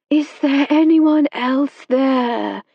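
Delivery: the medium herself again; medium's normal voice. A narrator's reading. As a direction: medium asking the table in her own voice. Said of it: "in her normal voice".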